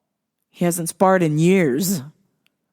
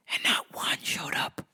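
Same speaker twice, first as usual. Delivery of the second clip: very soft, voiceless whisper; hushed whisper; 10 dB quieter than usual. Real, not acted. Host confessing nobody showed up, hushed whisper. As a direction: drop to a whisper for the sad reveal.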